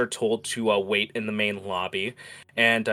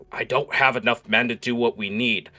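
raised, louder worried captain; urgent worry; 3 semitones higher, 7 dB louder than usual. Acted. Guard captain pleads for more men to royalty, urgent and worried. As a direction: press the point with rising alarm.

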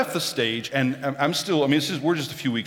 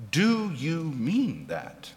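each word slow and soft, a quiet probing ask; slow gentle question; 3 semitones higher, 4 dB quieter, much slower than usual. Retold or imitated. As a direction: lay the question down slowly and softly, like a kind professor. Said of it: "He just asked me a question".

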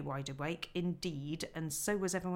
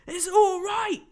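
loud, high, slow call from outside; shouting; 11 semitones higher, 12 dB louder, slower than usual. Acted. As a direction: call out to reassure those hidden in the cave. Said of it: "he was shouting".

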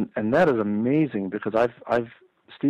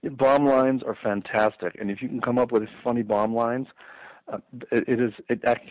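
usual speaking voice

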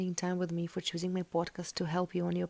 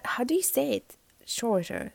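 ordinary talk, nothing marked

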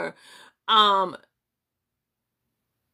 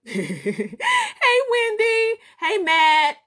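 high, louder laughing hellos; laughing greeting; 13 semitones higher, 3 dB louder, much slower than usual. Real, not acted. Greeting Wendy and Matt, laughing and high. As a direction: call out with a laugh.

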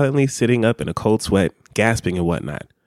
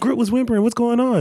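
much higher mock-alarmed voice; imitating waking questions; 12 semitones higher than usual. Partner mimics Randy waking up about Groot, high mock alarm. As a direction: mimic a worried partner who just woke up. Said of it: "You'll wake up and start asking".

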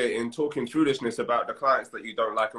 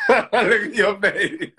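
higher, louder, through open laughter; laughing; 8 semitones higher, 7 dB louder, much slower than usual. Real, not acted. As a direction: crack up while talking. Cracking up at listener's face.

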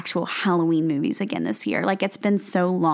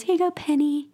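put-on creepy little girl; 7 semitones higher than usual. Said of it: "She goes".

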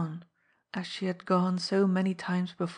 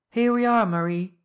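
loud, raised call to a companion; crying out; 3 semitones higher, 8 dB louder than usual. A narrator's reading. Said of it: "he cried".

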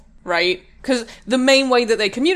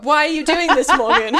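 giggling protest, higher; laughing; 7 semitones higher, 7 dB louder than usual. Real, not acted. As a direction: laugh through the words.

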